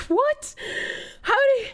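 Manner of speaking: usual speaking voice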